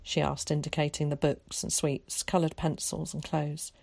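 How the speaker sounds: ordinary delivery, nothing marked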